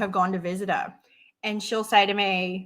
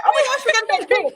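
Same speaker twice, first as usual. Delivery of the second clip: high, panicky mock alarm from the back seat; retold backseat panic at a roundabout, imitated high alarm; freaking out; 12 semitones higher, 3 dB louder than usual. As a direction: yelp it like a nervous passenger bracing for a crash. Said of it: "like, freaking out, going".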